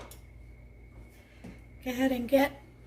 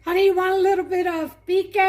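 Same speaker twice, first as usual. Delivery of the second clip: calling out; 4 semitones higher, 8 dB louder, faster than usual. Real, not acted. Calling out to someone nearby.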